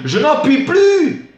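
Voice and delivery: loud, high, drawn-out cry of exasperation; theatrical high-pitched shout; furious outburst. Acted. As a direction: explode like a madman at breaking point. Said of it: "J'éclate en furie, et comme un fou".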